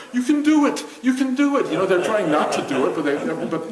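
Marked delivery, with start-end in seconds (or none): 0.1-1.7 high, loud cheer at the screen; root for them at the top of your voice. Retold or imitated. Viewer cheering a dieter on TV, high and loud. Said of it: "there you are watching this T V show and going".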